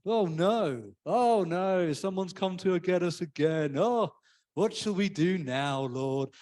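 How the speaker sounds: bored unbothered sigh, slowed; a lightly voiced laid-back groan. Shown as a demonstration. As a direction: shrug it off, mildly. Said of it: "I'm so laid back".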